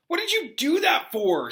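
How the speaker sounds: high angry outburst; Harry furious at the ghost. Acted. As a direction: snap it out furiously. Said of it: "said Harry furiously".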